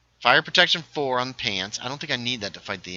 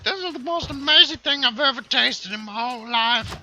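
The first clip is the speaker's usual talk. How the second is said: much higher, over-the-top tipsy voice; drunk high voice; 5 dB louder than usual. Pretend. Imagining being five beers in, gushing in a high drunk voice. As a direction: gush like a happy drunk. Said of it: "I start talking like this".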